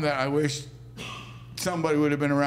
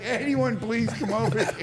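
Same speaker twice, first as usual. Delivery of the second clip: mock call for help; 7 semitones higher than usual. Calling for witnesses, laughing.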